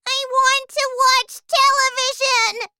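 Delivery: very high, loud squeaky tantrum; tiny boy shouting. A narrator's reading. Shrunken boy defying his father, shouting shrilly. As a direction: yell in a miniature child's voice. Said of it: "Mike started shouting".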